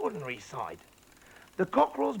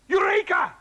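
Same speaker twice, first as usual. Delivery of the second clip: high, loud triumphant cry; sudden joyous eureka shout; cried out; 9 dB louder than usual. Acted. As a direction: shout it like a discovery. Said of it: "cried Mister Cranky".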